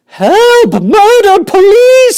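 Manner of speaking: extremely high, loud shrieks; a mother shrieking as her son vanishes up the pipe; screaming. Acted. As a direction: shriek for help hysterically. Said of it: "screamed Missus Gloop".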